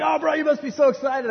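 high-pitched imitation of an eager friend; mock enthusiasm, pitched well up; gushing excitement. Brother on the phone to expecting father, imitated excitement. And he's like.

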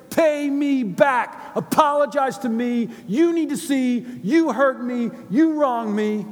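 loud, high angry demand; demanding servant. Acted. Unmerciful servant shouting at his brother, angry. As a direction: bark it like a man choking his brother.

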